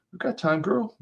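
high-pitched, easygoing friend voice; light, high character voice; chill friend. A narrator's reading. Friend soothing impatient friend in fiction.

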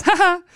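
high mock "ha ha"; imitated laugh. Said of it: "laughs, goes".

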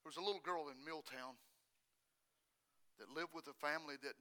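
low, very soft storytelling to a congregation; hushed preaching. Acted. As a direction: tell a grave testimony low and quietly. Preacher tells testimony in hushed voice.

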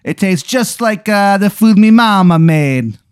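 put-on high Italian accent, slowing at the end; a cheery Italian host offers sausages. Alien offers food in a high Italian accent. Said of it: "he talks in an Italian accent".

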